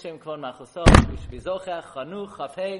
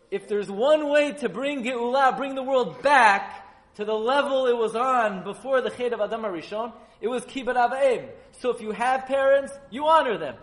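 high, loud preaching at full force; impassioned sermon peak; fiery preaching; 8 semitones higher, 9 dB louder than usual. Acted. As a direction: preach it out to the room, rising. Preacher raises voice to congregation.

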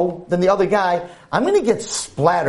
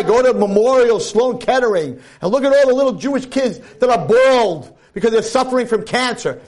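raised, urging sermon voice; earnest preaching; 6 semitones higher than usual. Acted. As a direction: press the audience to see real suffering. Preaching continues.

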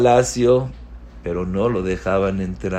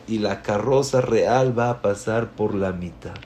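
ordinary talk, nothing marked

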